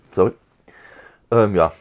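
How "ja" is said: usual speaking voice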